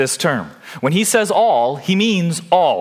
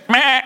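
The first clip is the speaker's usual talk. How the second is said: short stiff goat bleat, a touch higher; 8 semitones higher than usual. Retold or imitated. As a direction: bleat like a scared goat seizing up. Goat seizing up from fear.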